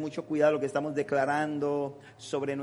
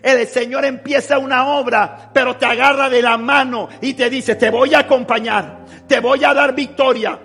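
loud, high fervent preaching with slow stretched phrases; preaching at full force; 8 semitones higher, 12 dB louder, slower than usual. Acted. Pastor preaching to the congregation at full force. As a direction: thunder it out like a revival preacher.

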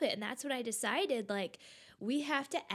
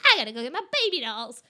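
put-on fast, loud excited kid; hurried child; 10 dB louder, much faster than usual. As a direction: rush it like an eager child. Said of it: "she's like".

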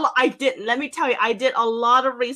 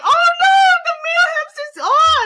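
high shouted oh no, much louder; panicked oh no; 7 dB louder than usual. Retold or imitated. Retelling finding the hamster gone, high panicked shout. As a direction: cry out in alarm, high and loud.

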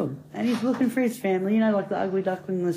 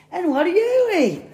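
wide-mouthed frog; 12 semitones higher, 6 dB louder than usual. Acted. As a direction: stretch the question out in a squealing frog voice. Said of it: "he goes".

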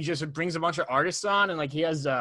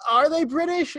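mock British accent; 12 semitones higher, 4 dB louder than usual. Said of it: "the only band that sing British".

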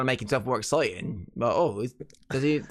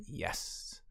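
breathy aside whisper; 15 dB quieter than usual. Real, not acted. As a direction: whisper it under your breath.

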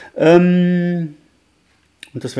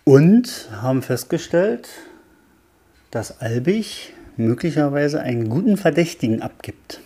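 story reading intro; 3 semitones lower than usual. Acted. Introducing the reading, chapter recap.

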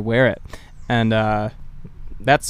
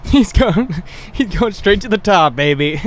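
high, loud hype; a high, loud, excited straight-to-the-top cheer; hyped up; 6 semitones higher, 3 dB louder than usual. Real, not acted. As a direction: sell it like a hype man. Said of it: "hyped joke about cousin".

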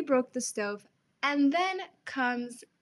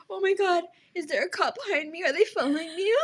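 imitated panic; 6 semitones higher, 4 dB louder, faster than usual. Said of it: "he starts panicking".

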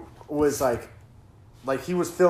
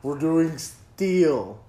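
put-on very slow drunk uncle drawl; slowed-down Trump; 3 dB louder, much slower than usual. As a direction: stretch every word like half speed. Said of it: "Donald Trump played at half speed".

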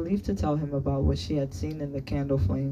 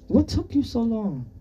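stern, higher-pitched demand; mildly scolding American-accented question; 9 semitones higher, 4 dB louder than usual. A narrator's reading. Teacher questions the late boy, sternly. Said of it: "Anatol asked sternly in English".

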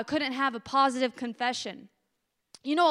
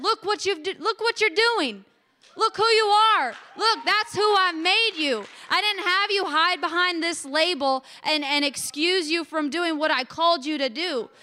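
higher and louder, preaching with full force; impassioned voice of the Lord; fervent preaching; 5 semitones higher, 6 dB louder than usual. Acted. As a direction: proclaim it like God speaking. God's voice rebuking her, preached.

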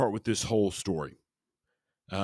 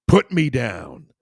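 loud, drawn-out yell, pitched up; raised-voice shout, falling at the end; screamed demand; 4 semitones higher, 9 dB louder, slower than usual. A narrator's reading. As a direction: scream it out slowly as a narrated plea. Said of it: "I screamed as well as I could".

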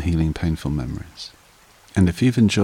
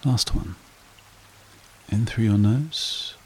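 slow, calm meditation guide voice; guided meditation; 6 dB quieter, slower than usual. Acted. Meditation host guiding listeners to relax, calm and unhurried. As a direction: ease the listener down into stillness.

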